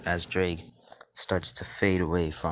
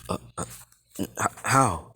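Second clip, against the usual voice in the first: stunned stammer. Acted. Drake appearing on Issei's hand, stunned stammer. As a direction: stutter as if too astonished to speak.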